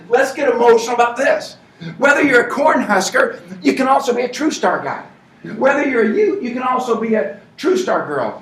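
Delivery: loud, high, driving pep-rally preaching; rousing rally speech. Acted. Team leader firing up the crowd about the Heat, shouting. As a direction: fire up the crowd at full volume.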